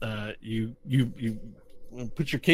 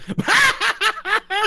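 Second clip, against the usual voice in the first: very high, loud cackling fit; hysterical laughing; 7 dB louder than usual. Acted. Spellbound gnome mocking the angry adventurer, hysterical high laughter. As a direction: cackle uncontrollably, far above your usual pitch. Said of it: "he starts laughing hysterically at what you say".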